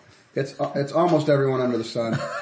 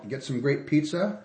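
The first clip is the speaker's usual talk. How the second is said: ordinary talk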